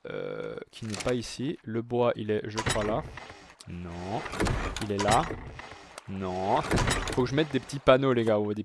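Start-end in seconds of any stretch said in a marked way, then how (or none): none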